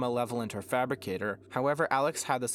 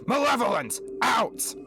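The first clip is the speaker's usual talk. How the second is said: high, loud barked order; commanding shout; 13 semitones higher, 8 dB louder than usual. A narrator's reading. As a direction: order her out at full shout. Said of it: "commanded Sentinel".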